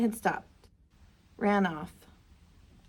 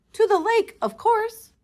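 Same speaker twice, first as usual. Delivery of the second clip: high, louder eager answer; excited; 11 semitones higher, 5 dB louder than usual. Acted. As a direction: cheerfully point the way.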